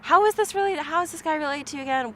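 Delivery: mock puzzled friend asking fast; friend's puzzled question. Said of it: "my friend is like".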